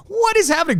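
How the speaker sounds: high-pitched incredulous question; mock bewilderment. Co-host retells watching the absurd show, hammed-up bewilderment. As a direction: squeak it in exaggerated disbelief. Acting out own bewildered viewer reaction.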